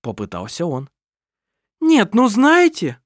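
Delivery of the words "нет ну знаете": loud, very high, slow outrage; indignant exclamation. Acted. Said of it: "воскликнула Лисин".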